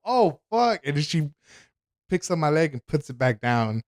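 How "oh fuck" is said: high, alarmed curse; nurse's alarm. Retold or imitated. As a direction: mimic the nurse gasping out a curse in shock. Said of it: "I just hear her go".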